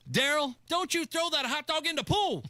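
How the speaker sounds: mock mom yelling at Daryl; mom yelling across the pool. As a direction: call out like a mother scolding her kid from the deck.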